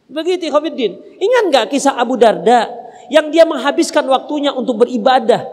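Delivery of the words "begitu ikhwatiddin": usual speaking voice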